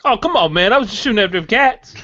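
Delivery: high, pleading and fast; whiny excuse. Retold or imitated. Acting the shooter's excuse.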